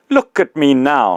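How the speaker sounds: put-on the big ghost confronting his old employee, loud and aggrieved; indignant big ghost.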